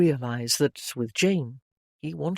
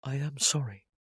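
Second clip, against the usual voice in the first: lowered voice; 3 semitones lower, 6 dB quieter than usual. A narrator's reading. Henry apologising to Jane, low and hushed. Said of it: "lowered his voice".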